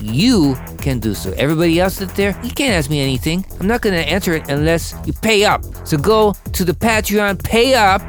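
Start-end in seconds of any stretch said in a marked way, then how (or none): none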